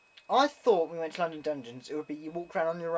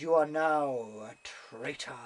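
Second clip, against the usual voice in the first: lightly voiced doom-laden sentence, drawn out; slow, solemn pronouncement in imitation of a guide; dungeon guide; slower than usual. Dungeon guide to boat riders, solemn imitation. Quotes the Traitor's Gate guide.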